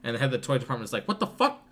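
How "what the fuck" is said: very high, louder incredulous squawk; mock shock. Toy department head hearing Santa's advice, in a high shocked squawk. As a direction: squawk it in disbelief.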